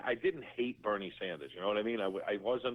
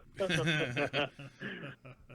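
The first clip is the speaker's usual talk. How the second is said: real laugh; 4 semitones higher than usual. Real, not acted. Laughing at the story.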